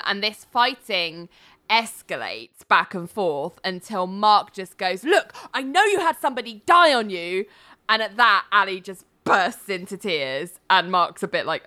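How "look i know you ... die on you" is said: mock argument outburst. Acted quarrel, fighting escalates.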